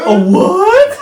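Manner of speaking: high, loud squeaky "A what?"; mock disbelief. Mocking the islanders who don't know sharks, squeaky laughing disbelief. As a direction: squeak it out in fake shock, laughing.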